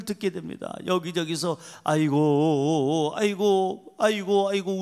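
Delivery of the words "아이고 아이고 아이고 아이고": slow drawn-out mock wail of grief; lightly imitated funeral lament; mourners' wailing. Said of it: "울기, 울기 시작하는 거예요".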